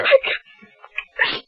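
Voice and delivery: breathless, broken whisper; exhausted wife whispers her refusal; whispered sob. Acted. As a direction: let it fall to a whisper.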